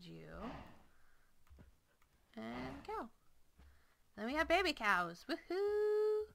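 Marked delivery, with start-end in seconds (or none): 5.3-6.2 high, loud happy whoop; excited cheer. Real, not acted. Baby cows born.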